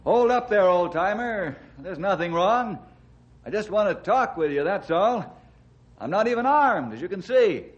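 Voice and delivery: calling out. Acted. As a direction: call out to him, keeping it friendly. Ranger calls out to armed hermit.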